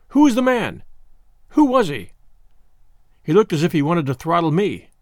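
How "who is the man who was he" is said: angry demand. Acted. Desperate demand for the man's name.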